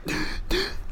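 unvoiced gasp for air; imitated choking gasp. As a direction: gasp like you cannot breathe. Retelling choking while driving.

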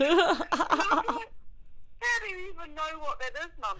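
mock surprise. Speaker quoting herself to Mum.

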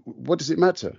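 usual speaking voice

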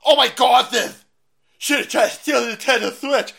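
loud, high mock outrage; mock outraged lefty. Mocking the left's reaction, loud, high and hammed up. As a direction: ham up a shocked leftist. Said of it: "the left-hand side goes".

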